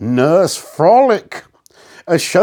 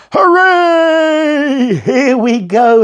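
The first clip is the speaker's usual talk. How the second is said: put-on high, loud old man's cheer; enthusiastic shout; 8 semitones higher, 4 dB louder, much slower than usual. A narrator's reading. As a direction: cheer like a freed old man. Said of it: "he shouted, most enthusiastically".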